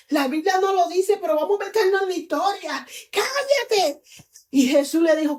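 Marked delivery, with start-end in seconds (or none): 3.1-4.0 high, scolding command; crowd hushing him. Acted. Crowd silencing the blind man calling Jesus, scolding. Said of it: "Y la gente le decía".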